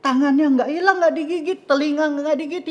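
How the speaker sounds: high, loud, incredulous exclaiming; astonished, puzzled king voice; baffled king. Acted. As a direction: check him over in loud disbelief. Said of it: "Terus raja bingung".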